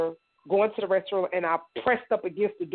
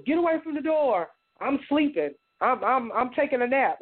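higher, tense father snapping through the door; father barking back; 5 semitones higher than usual. Retold or imitated. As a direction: shoo the boy away, trying to sound casual. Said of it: "He goes".

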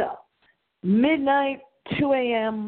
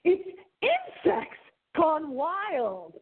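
slow, high, stretched-out title; hammed-up announcer; 8 semitones higher, much slower than usual. Host announcing tonight's show theme, hammed-up drawn-out call. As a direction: draw the title out high and theatrical.

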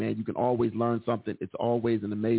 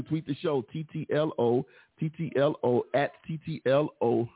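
ordinary delivery

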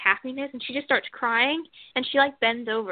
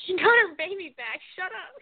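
high-pitched mock-tearful protest; retold emotional retort; 8 semitones higher than usual.

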